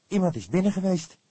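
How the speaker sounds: put-on startled young detective; alarmed young man. A narrator's reading. Fledder alerts the cook, high and loud. Fledder finds broken seal.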